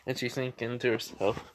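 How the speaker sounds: ordinary delivery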